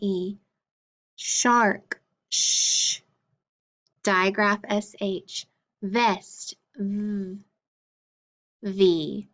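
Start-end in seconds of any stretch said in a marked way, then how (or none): 2.3-3.0 "sh" as one long hushing sound, no vowel; isolated letter sound. Shown as a demonstration. Teacher drilling the sound in shark, isolated letter sound. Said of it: "we're gonna say the sound". 6.8-7.4 "v" as a buzzing consonant, not the letter name; isolated letter sound. Shown as a demonstration. Teacher drilling the first sound of vest, isolated letter sound. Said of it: "we're gonna say the sound".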